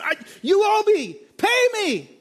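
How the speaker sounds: high, loud snarl of a creditor; acted angry demand. The servant seizes his debtor by the throat, a loud high demand. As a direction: bark it out as he grabs the throat.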